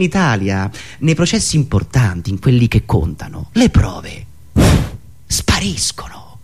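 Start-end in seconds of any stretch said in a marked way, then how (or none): 5.3-6.4 hushed whispered punchline. Acted. Comedian revealing that evidence vanishes, whispered and slowed. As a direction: let the word vanish into a whisper.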